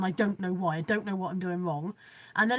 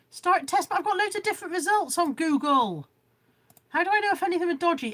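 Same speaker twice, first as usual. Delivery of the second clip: tech panic; 7 semitones higher, 3 dB louder than usual. Real, not acted. Anxious at speed test results.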